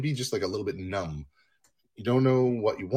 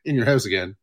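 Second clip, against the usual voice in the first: loud, raised-pitch alert; friend's heads-up; 4 semitones higher, 7 dB louder than usual. Retold or imitated. Imagined friend phoning about an intruder, urgent heads-up. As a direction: call out a warning to a buddy. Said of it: "just be like".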